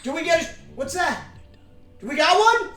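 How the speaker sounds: high, loud excited squeal; thrilled calls at a surprise donation; bursting with delight. Real, not acted. Reacting to a donation alert.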